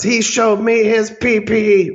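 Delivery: mock kindergarten tattler; childish whine. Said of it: "it's like kindergarten out there".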